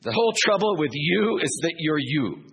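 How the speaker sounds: preacher mocking faultfinders, scolding; nagging critic. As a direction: jab it like a nagging churchgoer. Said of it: "A lot of people like that in the church".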